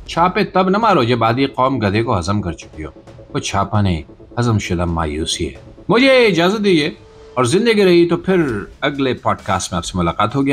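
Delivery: ordinary delivery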